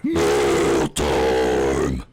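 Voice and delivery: very low, drawn-out bellow; hammed-up low segment shout; announcer call. Called-out segment jingle.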